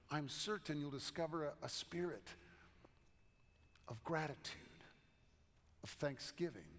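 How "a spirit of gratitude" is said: ordinary delivery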